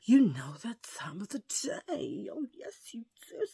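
hushed, secretive whisper; conspiratorial whisper to the viewers. Pretend. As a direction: lean in and whisper the tease. Teasing whispered segment intro.

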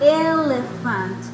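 "elephant" slow and loud, first "e" drawn out; stretched opening vowel. Shown as a demonstration. Teacher shows students it is a vowel too, slow stressed "elephant". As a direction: lean long on the opening "e" of "elephant". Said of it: "Vean, es una vocal también".

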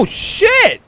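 high, loud startled curse; real startled outburst. Real, not acted. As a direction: blurt it in sudden shock. Sudden surprise in game.

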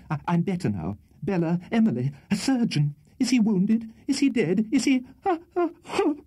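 high voice climbing into a hysterical fit. A narrator's reading. Spinster aunt asking after wounded Tupman, hysterical. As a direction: build from faint and high to squealing gasps. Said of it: "fit number two of hysteric laughter".

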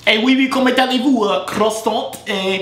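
hammed-up French accent; bad French accent, high and playful; mock French accent.

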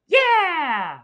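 cheering yeah. Acted. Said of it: "think of some song".

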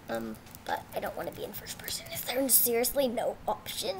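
fast hushed aside; quick whisper. Real, not acted. Kid grumbling at game settings in a whisper. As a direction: mutter it under your breath, quickly.

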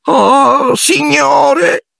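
very high, tearful elf wail; Dobby gasping. A narrator's reading. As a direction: gasp it out on the edge of tears. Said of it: "ansimò". (ansimo piagnucoloso, acutissimo)

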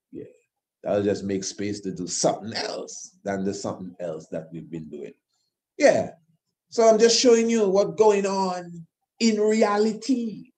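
usual speaking voice

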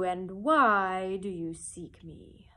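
slow, loud, strange wizard head; slow, loud voice of the great head; booming Oz. Acted. The great head Oz questions Dorothy, slow and booming.

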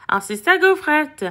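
plain reading voice

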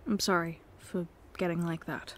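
ordinary delivery

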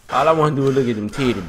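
ordinary talk, nothing marked

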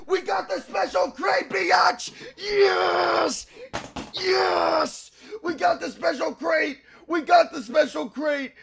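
high, squeezed cheering; strained elated squeal. Real, not acted. Player overjoyed at the special crate, strained elated squeal. Celebrating, voice straining after screams.

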